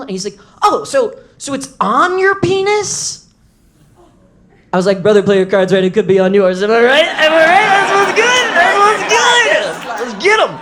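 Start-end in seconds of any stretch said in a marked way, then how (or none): 0.6-3.3 high, slow puzzled doctor; a hint of a baffled doctor; comic asks, imitating the doctor, high and slow; clueless doctor. 5.0-10.5 loud, rising, high bro swagger; loud high bragging to the doctor; hyped bro boast. Retold or imitated.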